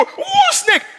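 very high, loud startled yelp; alarmed shriek. Retold or imitated. Churchgoer hearing snake, high alarmed shriek. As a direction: shriek it as if a snake were at your feet. Acting someone spotting a snake.

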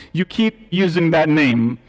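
a bad Spanish accent quoting the movie; preacher doing a clumsy Spanish accent movie line; poor Spanish accent. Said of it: "I apologize for the poor Spaniard accent".

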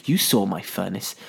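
lightly voiced sinister killer; retold hushed threat; menacing villain. Villain accuses hero in retelling.

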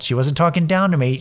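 ordinary delivery

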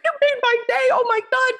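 high emotional gasp of gratitude; teary and moved. Retold or imitated. Thanking the former bank teller, high and teary. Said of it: "I'm crying now".

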